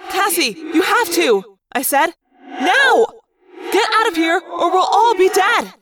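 urgent shout. Acted. Rachel shouting at Cassie to escape, high and loud. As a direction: drive her off, shouting.

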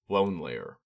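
plain reading voice

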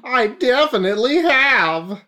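high pious preacher voice; a high, kindly priest greeting; Armadillon. Acted. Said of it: "It's Father Armadillo Don now".

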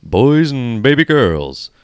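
big booming showman announcer; booming kids show opener. Acted. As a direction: boom it out like a ringmaster. Podcast intro announcer opener.

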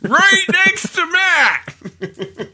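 high, slow, stretched-out shout; hammed-up live-report yell; mock yelling. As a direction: holler every word like a reporter over engine noise. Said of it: "Can you just yell".

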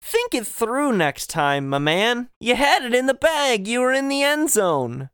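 high, exasperated pep-talk scolding; mock coaching. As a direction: plead like a let-down coach.

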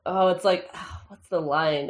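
ordinary talk

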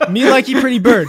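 high, loud, laughing squawk; parrot voice. Pretend. Squawked parrot-style repeat, laughing.

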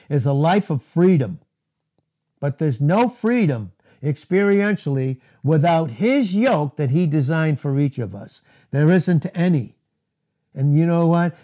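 normal talking voice

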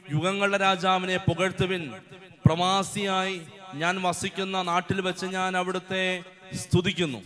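loud fervent scripture proclamation; preaching at full force. Acted. Preacher proclaiming Tobit's praise to the congregation, loud and fervent. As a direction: proclaim it with fire.

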